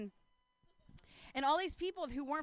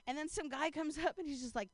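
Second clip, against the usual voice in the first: ordinary talk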